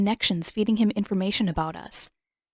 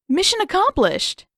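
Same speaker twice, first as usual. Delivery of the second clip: gleeful, much higher and louder; 11 semitones higher, 4 dB louder than usual. A narrator's reading. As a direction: crow with delight at the trick. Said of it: "Aang said gleefully".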